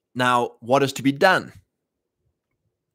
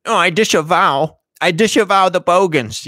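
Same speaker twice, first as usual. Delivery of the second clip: higher and louder, a hammed-up prissy "I disavow"; mock disavowal; 7 semitones higher, 5 dB louder than usual. Mocking conservatives who denounce the bogans, in a prissy mock voice. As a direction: parody a pearl-clutching politician. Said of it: "I'm not gonna be like".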